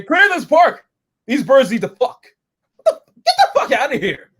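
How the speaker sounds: high, loud, hammed-up ordering about; exaggerated outraged command; mock angry warden. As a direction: shout people away in a mock rant.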